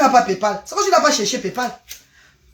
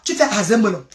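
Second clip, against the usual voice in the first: usual speaking voice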